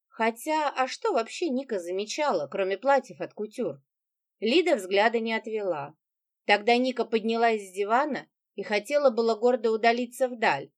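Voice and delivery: ordinary delivery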